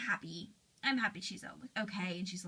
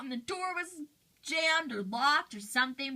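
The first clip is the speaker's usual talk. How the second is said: ordinary talk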